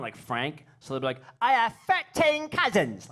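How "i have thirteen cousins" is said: high, loud, slow mock English accent, thirteen with an F; aggressive English accent. Comic mocking English friends' speech, aggressive exaggerated English accent. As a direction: push a toothy, in-your-face English accent. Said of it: "like that, with their teeth in your face, aggressively wrong".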